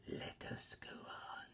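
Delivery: slow, very soft whisper; hushed spirit. A narrator's reading. Ghost leading Scrooge onward, slow whisper. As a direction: breathe it out slowly.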